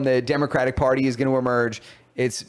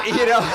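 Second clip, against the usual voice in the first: high, breathless burst of laughter; cracking up mid-story; laughing hard; 9 semitones higher than usual. Real, not acted. Laughing at the moderator bit he just told.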